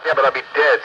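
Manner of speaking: ordinary delivery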